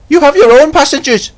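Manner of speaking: lightly voiced stern driver; sternly. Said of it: "said James's driver sternly".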